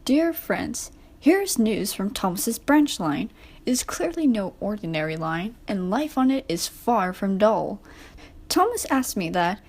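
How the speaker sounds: ordinary delivery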